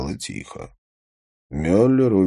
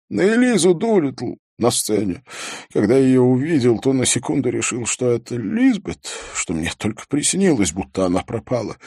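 higher, hurried confession; agitated producer; 7 semitones higher, 4 dB louder than usual. Acted. Producer telling of his missing Lisbeth, agitated. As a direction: let the words tumble out in a raised pitch.

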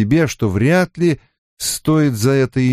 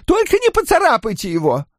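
very high-pitched character voice; shouting innkeeper; faster than usual. A narrator's reading. Innkeeper directs furniture rescue at fire, high-pitched shouting. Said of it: "кричал Куале".

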